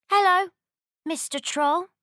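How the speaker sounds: high, loud bright greeting; cheerful little goat. Acted. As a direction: greet him innocently and brightly. Baby Goat greets troll.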